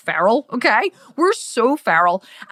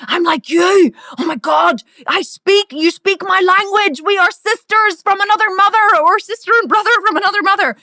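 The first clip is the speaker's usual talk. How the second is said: higher, louder, gushing delight; an enthusiastic fan cheers; mock excited fan; 7 semitones higher, 4 dB louder than usual. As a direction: squeal it like a thrilled new fan. Excited fan reaction quoted.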